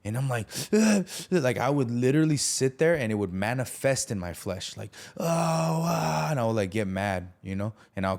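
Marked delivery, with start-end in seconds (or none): 0.7-1.1 sharp, loud, high groan of frustration; frustrated groan. Retold or imitated. Recalling his rage to the host, a loud frustrated groan. 5.2-6.3 high, strained oh-ah groan. Retold or imitated. Describing rage in his flesh, a high strained groan.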